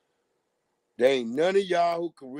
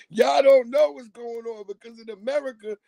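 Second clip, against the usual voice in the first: heated shout; 10 semitones higher, 6 dB louder than usual. Real, not acted. Heated rant.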